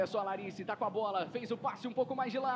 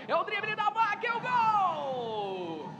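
louder, stretched goal cry; excited goal call; 4 semitones higher, 6 dB louder, slower than usual. Real, not acted.

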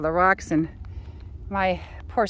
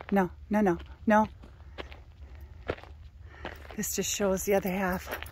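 ordinary talk, nothing marked

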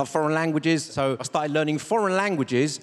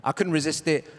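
ordinary delivery

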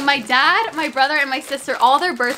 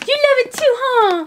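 1 pet talk; 12 semitones higher than usual. Real, not acted. Talking to her dog.

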